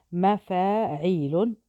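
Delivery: slow, syllable by syllable; teacher scanning مفاعيل slowly; drawn-out scansion. Shown as a demonstration. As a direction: stretch each syllable of مفاعيل out. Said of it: "syllable-by-syllable scansion".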